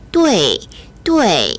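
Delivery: 对 said slowly as "du-ei"; model 对 with "e". Shown as a demonstration. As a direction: let an "e" sound into 对, falling tone. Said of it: "이런 식으로 발음을 하셔야 됩니다".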